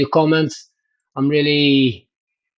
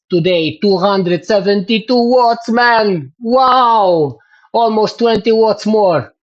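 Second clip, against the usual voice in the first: excited; 5 semitones higher, slower than usual. Real, not acted. Reading out his new best watts.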